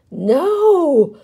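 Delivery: long, high, loud wail of alarm; alarmed cry. A narrator's reading. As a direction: cry out as someone falls. Crowd sees him falling.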